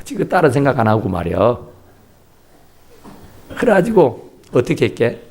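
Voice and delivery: usual speaking voice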